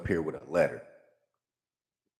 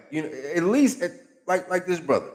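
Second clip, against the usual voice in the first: excited; 9 semitones higher than usual. Acted. Excited pointing out good example.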